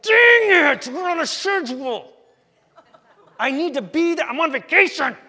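high, loud mock shout, half laughing; hammed-up road-rage dad stuck at roadwork; frustrated driver rant. Dad stuck behind a flagger sign, mock shouting at traffic. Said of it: "and you're like".

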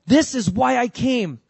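high, loud, slow proclamation; emphatic declaration. Acted. Jesus telling his guys his purpose, emphatic declaration. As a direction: drive each word home, slowly and loudly.

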